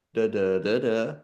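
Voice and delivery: normal talking voice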